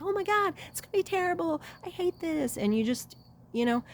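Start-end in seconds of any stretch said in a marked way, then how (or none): 0.0-2.6 put-on high, anxious whine of a scared kid; mock panic. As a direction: imitate a kid dreading the shot. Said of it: "you were like".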